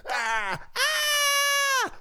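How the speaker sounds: shrill terrified shriek; high scream. Acted. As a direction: shriek in terror. Pig screams.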